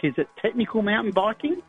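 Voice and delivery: ordinary talk, nothing marked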